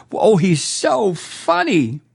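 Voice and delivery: slow, sneering imitation of a fan gushing; mock fawning fan. Mocking the crowd who cheer Trump, slow sneer. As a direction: drawl it out as a gushing, mocked fan.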